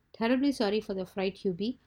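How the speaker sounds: plain reading voice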